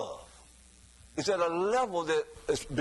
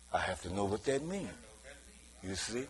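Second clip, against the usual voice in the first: normal talking voice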